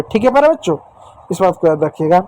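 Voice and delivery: normal talking voice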